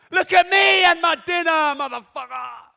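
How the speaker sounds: high, loud mocking show-off; show-off shout. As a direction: yell it like a bragging poster. Mocking show-off dinner poster.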